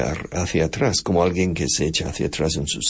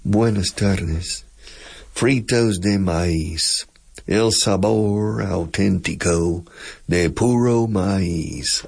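slow Texas drawl on Spanish words; slower than usual. Shown as a demonstration. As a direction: stretch the Spanish vowels out like a Texan. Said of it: "hablar alargando las palabras".

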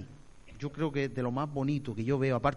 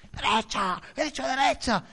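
la carne ordena "derecha" más alto y cada vez más agudo; carne mandona gritando; 11 semitones higher, 4 dB louder than usual. Acted. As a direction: como conductor furioso que exige girar. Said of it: "grita mucho". (la carne grita órdenes desde el volante, enfadada)

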